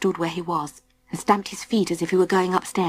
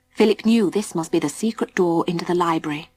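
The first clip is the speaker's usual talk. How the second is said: usual speaking voice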